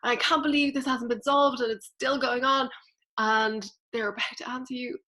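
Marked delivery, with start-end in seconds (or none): 0.0-2.7 louder, higher, fed-up ranting; imitating an exasperated rant; mock rant. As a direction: vent at the angels like you've had enough. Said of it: "you start ranting and saying".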